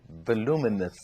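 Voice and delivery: usual speaking voice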